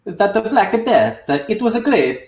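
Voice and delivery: high, hammed-up Italian accent; mock Italian accent, pitched high. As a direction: play a comic Italian saying it. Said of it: "can you do a good Italian accent?".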